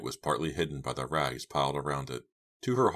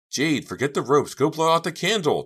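high shout, climbing higher; urgent cry; 12 semitones higher, 8 dB louder than usual. A narrator's reading. Girl ordering friend to put out flame, crying out. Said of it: "Dina cried".

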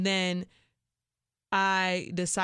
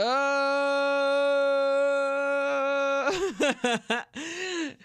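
loud burst of laughter; 8 semitones higher, 5 dB louder than usual. Real, not acted. Laughing at his request.

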